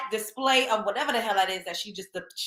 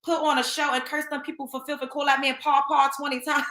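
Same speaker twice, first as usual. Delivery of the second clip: ordinary delivery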